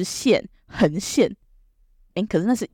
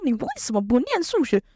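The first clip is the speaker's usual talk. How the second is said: angry teacher; 7 semitones higher than usual. Retold or imitated. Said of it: "他说".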